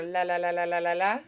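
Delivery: quick tongue flicks on "la la la"; rapid light "la" syllables in a row; rolled r exercise. Shown as a demonstration. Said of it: "Or, like, a really fast L".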